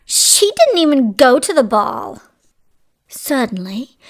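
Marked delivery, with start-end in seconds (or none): 0.0-2.2 loud, indignant stepmother voice; furious shriek. A narrator's reading. Stepmother denies Cinderella was at the ball, furious. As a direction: protest loudly in storybook outrage. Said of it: "shrieked her furious stepmother".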